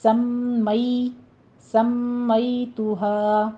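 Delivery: سَمَّيْتُهَا with a nasal doubled mim; سَمَّيْتُهَا said slowly, the mim held; slow model with a held nasal mim; tajweed pronunciation. Shown as a demonstration. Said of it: "میم مشدد غنہ واجبہ".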